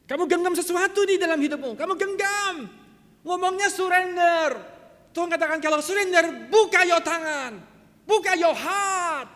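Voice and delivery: loud, high preacher's cry; full-force preaching. Real, not acted. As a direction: preach it out forcefully at the top of your range. (khotbah lantang berapi-api)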